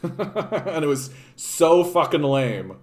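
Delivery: slow, laughing through the words. Real, not acted. Recalling mom's lame forgiveness ritual to the group, laughing. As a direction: laugh it out, embarrassed.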